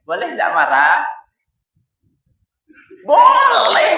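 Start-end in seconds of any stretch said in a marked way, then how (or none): 3.0-3.9 high, loud joking yell; high shouted answer. Pretend. Preacher playing a stubborn listener, high loud yell. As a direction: blurt it out loud like a defiant student.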